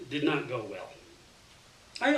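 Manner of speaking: ordinary delivery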